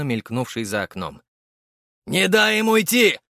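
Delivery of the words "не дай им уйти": loud high battle cry; yelling. A narrator's reading. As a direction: bellow it while running. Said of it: "заголосил бегущий следом боец".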